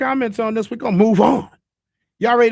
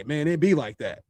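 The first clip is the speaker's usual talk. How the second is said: resigned shrug; 3 semitones lower, 4 dB quieter than usual. Retold or imitated.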